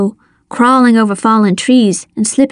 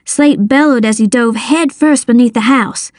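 usual speaking voice

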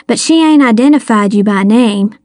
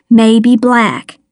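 ordinary delivery

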